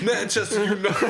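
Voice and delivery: put-on high, loud laughing British accent. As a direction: shout it like a football fan, laughing. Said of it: "best British accent I've heard come out of you".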